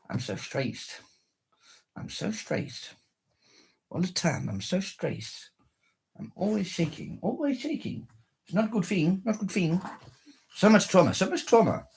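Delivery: soft, whiny mock despair; mock stressed lament. To himself, a hammed-up soft lament of stress. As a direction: sigh it out like a fake tragic soul.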